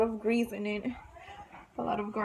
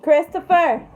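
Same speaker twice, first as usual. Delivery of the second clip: calling out; 6 semitones higher, 12 dB louder than usual. Real, not acted. Calling husband.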